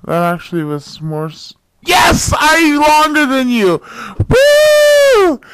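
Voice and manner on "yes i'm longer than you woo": loud triumphant whoop; excited cheer. Real, not acted. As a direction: celebrate winning, shouting. Cheering about video length contest.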